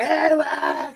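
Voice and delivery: high-pitched imitation shout over music; mock yell. Buddy over blasting club music, mock yell. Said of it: "'cause you can't hear the music".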